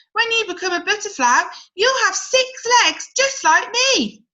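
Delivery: a grasshopper proud of six legs; boastful grasshopper. Acted.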